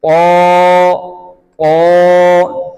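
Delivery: क़ा full and rounded, on a level pitch; heavy taa. Shown as a demonstration. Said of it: "क़ा को मोटा करेंगे". (क़ा को मोटा करके)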